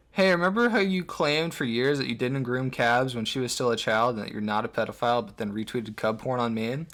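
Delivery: ordinary talk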